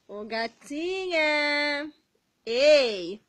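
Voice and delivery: slow, drawn-out loud call to wake her; playful loud wake-up call. Acted. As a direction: stretch it out loud to wake the baby. Loudly waking the baby.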